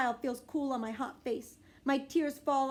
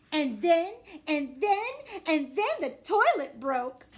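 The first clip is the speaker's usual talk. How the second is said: dramatic build-up; 8 semitones higher, 9 dB louder, slower than usual. A narrator's reading. Building up to a silly punchline.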